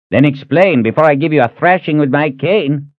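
put-on high, huffy old man's voice; cranky threat. Reuben threatening Ahern with his cane, high and huffy. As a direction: threaten like a feisty grandfather.

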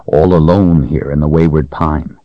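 ordinary delivery

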